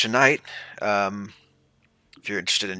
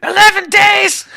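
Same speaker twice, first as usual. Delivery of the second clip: very high, loud, slower squeaky shout; Elmo voice; 16 dB louder, slower than usual. Acted. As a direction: drag out a frantic puppet squeal. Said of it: "Okay, Elmo".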